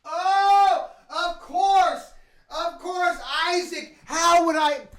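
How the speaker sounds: light up at a friend's name; thrilled recognition; delighted surprise. Real, not acted. Spots friend's name in chat.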